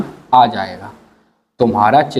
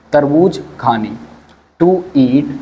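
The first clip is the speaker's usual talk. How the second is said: ordinary delivery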